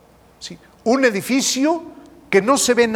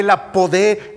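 ordinary talk

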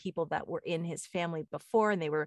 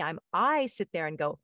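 ordinary delivery

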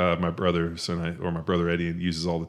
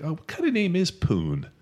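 quoting Fletch; 10 semitones higher than usual. Retold or imitated. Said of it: "he's like".

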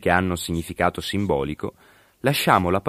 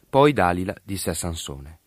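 usual speaking voice

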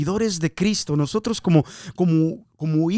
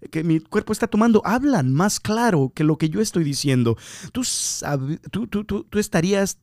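ordinary talk

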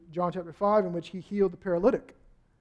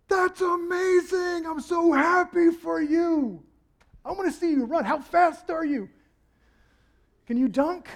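overjoyed crowd; 9 semitones higher, 4 dB louder than usual. Acted. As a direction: cheer him on like a thrilled bystander. Crowd cheering the healed man.

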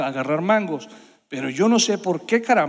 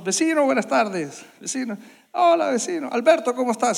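higher-pitched, sing-out hello; innocent greeting; 6 semitones higher than usual. Retold or imitated. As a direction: kids caught out, greeting the neighbor. Kids on roof greeting the neighbor.

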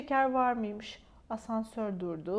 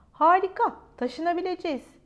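excited; 6 semitones higher, 7 dB louder than usual. Acted. Child thrilled about moving.

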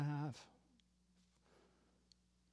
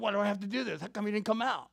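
raised, louder mock whining; whining complaint; 5 semitones higher, 4 dB louder, faster than usual. General complaining to his servant, whining. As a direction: play a whining general who resents the order. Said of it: "He's, like, complaining. He's such a whining general".

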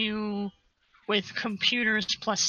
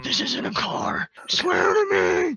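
hammed-up movie vigilante threat, last words drawn out; Batman; 10 semitones higher, slower than usual. Vigilante interrogating a captive, rasping mock threat.

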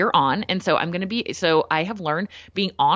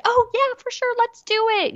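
much higher, gushing over-eager agreement; eager yes. Retold or imitated. People pleaser eagerly saying yes to plans. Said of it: "instead of just being like".

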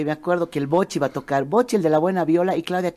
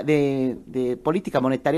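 ordinary delivery

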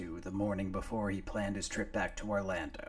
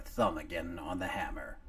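ordinary delivery

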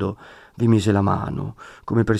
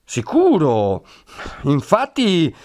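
higher, mocking ma bene, ma bravo; scornful mock praise of the friar; sarcastic; 6 semitones higher, much slower than usual. A narrator's reading. The count sneering at the friar's meddling, sarcastic.

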